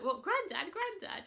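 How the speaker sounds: high, loud double-take on granddad; shocked disbelief. Retold or imitated. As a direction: react like you can't believe it. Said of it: "And he's like".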